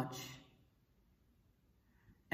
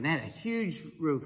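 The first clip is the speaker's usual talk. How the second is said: ordinary talk, nothing marked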